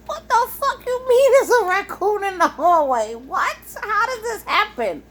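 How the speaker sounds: can't believe what she's hearing; incredulous. Real, not acted. Disbelief at raccoon news.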